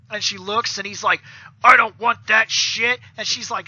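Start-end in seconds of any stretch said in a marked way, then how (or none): 1.6-3.0 loud, slow refusal in a character voice; put-on monster voice, dragging the words out; grumpy Goldar. Goldar turns down the lady's taffy, loud grumpy monster voice.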